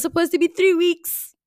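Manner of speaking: high, loud burst of disbelief; exclaiming. Real, not acted. Podcaster owning up to a two-month break, exclaiming. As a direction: pitch it right up and loud.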